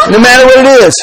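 normal talking voice

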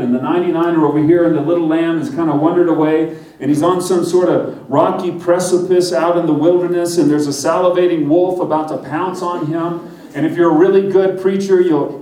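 ordinary talk, nothing marked

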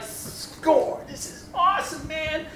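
high, breathy, excited inner cheer; gleeful thought. Retold or imitated. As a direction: pastor thrilled at the packed crowd. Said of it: "I'm like".

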